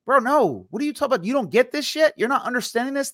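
can't believe they still don't get it; exasperated. Acted. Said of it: "turns around and looks at him and says".

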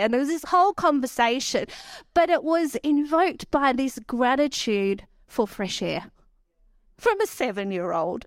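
ordinary delivery

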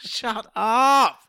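half-joking fed-up cry; mock exasperation. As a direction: tell off a silly co-host. Exasperated at the silly voice.

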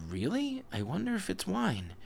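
very high, wondering voice; curious wonder. A narrator's reading. Baal Shem hears of a red spring, high-pitched curiosity. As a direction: squeak it with storybook curiosity.

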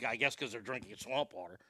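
soft whispered punchline; whispered aside. Real, not acted. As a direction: drop to a whisper for the joke.

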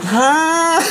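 high, drawn-out mock moan; Cam's moan. Host mimicking quarterback's signal call, high R and B moan. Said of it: "He just kinda moans out there".

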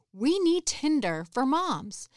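declaring the idea to fellow moms; emphatic announcement. Retold or imitated. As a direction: sound it out like a big eureka pitch. Announcing her big idea.

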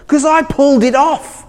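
put-on high, earnest little girl; young daughter announcing she's been bitten; daughter's voice. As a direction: play the stung eight-year-old calling to Dad.

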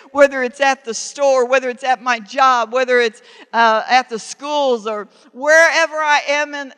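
loud pleading prayer, building; fervent prayer. Acted. Preacher voicing a believer's prayer, pleading loudly. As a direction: pray it out loud to God.

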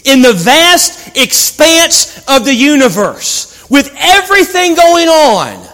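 slow, high, loud proclamation; building sermon climax. Acted. Preacher proclaiming the vast universe, soaring intensity. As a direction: stretch it out and raise it to a peak.

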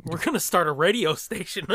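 laughing through the words. Real, not acted. Joking about quitting to podcast, laughing. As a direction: giggle the line out.

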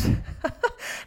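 airy laugh bursting out; a real giggle, high and unvoiced; high breathy laugh. Real, not acted. Laughs after quoting husband.

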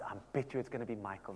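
hushed gossip whisper; a quiet conspiratorial guess. Acted. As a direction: lean in and whisper the bet. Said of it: "[name] is whispering".

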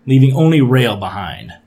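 usual speaking voice

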